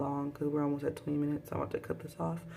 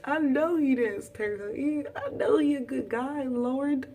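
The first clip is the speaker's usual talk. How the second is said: high, begging plea to the Lord; pleading prayer; 7 semitones higher than usual. Retold or imitated. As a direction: pray desperately he's good.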